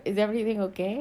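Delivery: a hint of a Spanish accent; light Spanish accent. Alejandro asking Jade from the balcony, light Spanish accent. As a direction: ask gently with a faint Spanish accent. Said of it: "It's more Spanish".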